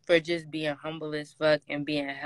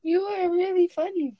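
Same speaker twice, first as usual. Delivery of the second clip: laughing; 12 semitones higher, 4 dB louder than usual. Real, not acted. Laughing at partner.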